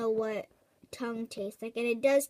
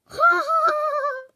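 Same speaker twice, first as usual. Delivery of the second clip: high, loud, chirping laugh; bird-like laugh; 8 dB louder than usual. Acted. Friends mocking the complainer, laughing high like a bird. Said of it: "your laughs sound like a bird".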